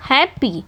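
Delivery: हैप्पी divided as हैप्, पी; two separate syllables. Shown as a demonstration. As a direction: say हैप्पी in two distinct beats. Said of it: "two syllable है".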